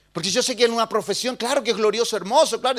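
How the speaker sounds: loud, high, pleading sermon delivery; preaching at full force. Acted. Pastor urges the congregation to finish their course, loud preaching.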